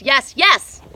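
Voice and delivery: yes building to a loud high shout. Real, not acted. Reader saying yes to the universe outdoors, shouting. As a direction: cry it out with joy. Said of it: "I say yes to the universe".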